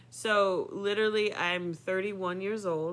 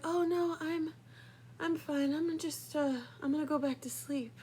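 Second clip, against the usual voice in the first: bright, higher fake-casual girl; high, airy brush-off; 9 semitones higher, 4 dB quieter than usual. Girl covering her scream to the father, high and airy. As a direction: pretend nothing happened, high and light. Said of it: "And so I was like".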